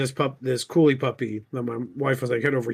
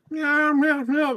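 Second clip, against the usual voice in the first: high mock cat meows; imitating meows; 12 semitones higher, much slower than usual. A cat pilot meowing over the radio, high and silly. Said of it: "just meows on the radio".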